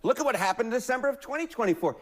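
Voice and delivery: ordinary talk, nothing marked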